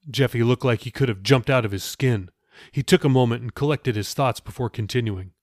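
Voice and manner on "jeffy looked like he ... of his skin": plain reading voice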